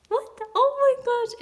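high loud shrieked what, oh my gosh; shrieking in delight; fangirl shriek. Real, not acted. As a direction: scream with joy. Pulled Johnny card.

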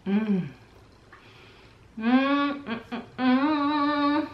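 sipping through straw, humming; drinking through a straw. Real, not acted. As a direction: lips sealed round straw. Seen 0: Straw held in lips throughout the humming; removed by +5.2.

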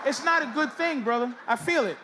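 ordinary talk

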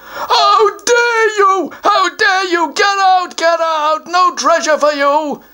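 high, slow mock-sobbing troll yell; a troll chasing off an intruder; outraged troll. Troll orders the child out after being called ugly, mock outrage.

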